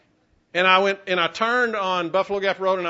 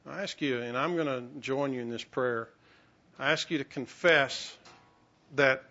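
leading prayer. Acted. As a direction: guide the room gently into prayer. Pastor leads congregation in prayer.

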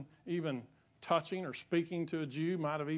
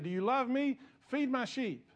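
higher, impassioned plea; fervent plea; 8 semitones higher than usual. Acted. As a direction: lift it up as Jesus' urgent question. Preacher voicing Jesus' plea.

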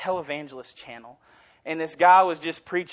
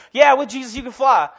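preacher's cheerful affirmation, louder; mock preacher; 5 semitones higher, 8 dB louder than usual. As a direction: toss it off like a fired-up preacher. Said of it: "the preacher's just like".

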